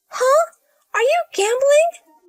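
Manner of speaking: high, loud and slow, near a cry; shocked disbelief. Acted. Elia reacts to her sister-in-law gambling, shocked. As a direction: reel at the news, voice cracking upward.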